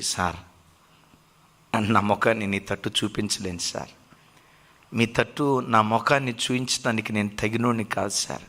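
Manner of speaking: low, soft, broken confession, lightly voiced; a hint of a sobbing prisoner too ashamed to look up; a low, mildly tearful plea to the judge; ashamed weeping. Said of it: "వెక్కి వెక్కి ఏడుస్తున్నాడట".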